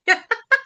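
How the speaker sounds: risa aguda y breve. Real, not acted. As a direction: suelta una risita alta. Se ríe recordando. (risita aguda de recuerdo)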